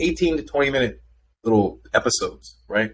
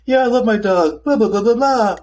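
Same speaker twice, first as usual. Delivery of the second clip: high gushing dog-owner voice, much higher and louder; mock dog owner; 11 semitones higher, 4 dB louder than usual. Mocking dog owners who claim to understand their pets. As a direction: gush about your dog in a silly high voice. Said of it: "So they have these folks who are like".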